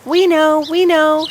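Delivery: chirpy sparrows. Acted. Sparrows teasing the fir tree, high sing-song. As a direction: chirp it out like excited little birds. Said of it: "sang the sparrows".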